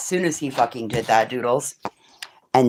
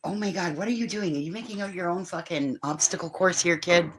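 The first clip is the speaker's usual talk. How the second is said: higher, softer amused disbelief; amused surprise; 6 semitones higher, 8 dB quieter than usual. Real, not acted. Amused at child.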